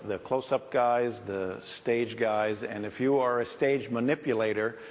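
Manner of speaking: ordinary talk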